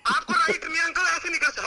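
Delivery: very high, urgent objection; real panicked protest. Real, not acted. Victim telling uncle he has no right, panicked. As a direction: protest frantically, voice shooting up.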